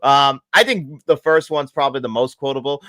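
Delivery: normal talking voice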